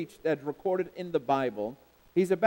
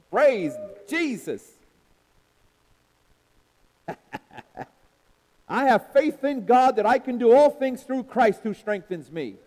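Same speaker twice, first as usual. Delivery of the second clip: loud rhythmic preacher cadence; preaching; 5 semitones higher, 6 dB louder than usual. Acted. Preacher rousing the congregation, loud and rhythmic. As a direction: preach it out to the church, full force.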